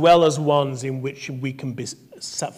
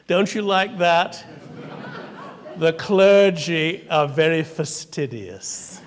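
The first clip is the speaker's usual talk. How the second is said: ordinary delivery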